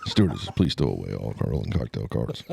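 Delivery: low cabin announcement; a hint of a captain on the intercom; pilot voice. Continuing the pilot voice game.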